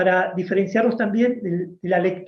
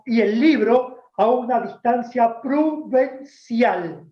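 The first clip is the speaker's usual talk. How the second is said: slow, higher voice of a stern schoolteacher; strict teacher; 4 semitones higher, slower than usual. Retold or imitated. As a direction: lay down the rule like a strict teacher. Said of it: "la señorita decía". (maestra estricta, lenta y aguda)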